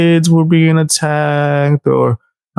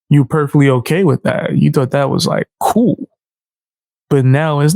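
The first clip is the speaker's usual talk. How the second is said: ordinary talk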